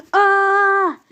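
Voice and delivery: put-on high, loud scream of a tumbling boy; falling scream. A narrator's reading. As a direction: yell as you fall through the sky. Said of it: "screamed the boy".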